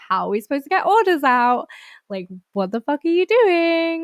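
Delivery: loud, high, outraged demand; retold rant at a hopeless teammate; exasperated yelling. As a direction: raise the voice in mock-furious disbelief. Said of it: "And I'm like".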